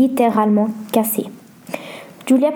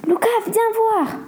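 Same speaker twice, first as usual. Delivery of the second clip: terrified; 11 semitones higher than usual. A narrator's reading. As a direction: call him over in mild storybook fright. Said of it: "dit terrifiée Julia". (effroi joué, voix haut perchée)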